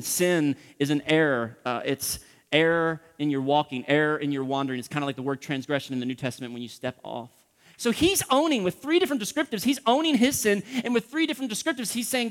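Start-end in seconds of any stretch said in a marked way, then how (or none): none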